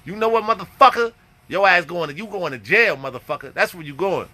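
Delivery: usual speaking voice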